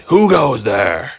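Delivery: gruff troll challenge. Acted.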